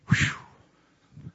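breathless whew after running; winded exhale. Real, not acted. Preacher catching his breath after running the aisle. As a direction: blow out air, out of breath. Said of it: "I gotta quit running like that".

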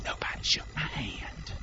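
hushed mock whisper; whispered complaint. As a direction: whisper it like a petty complaint in someone's ear. Voicing religion's petty complaint.

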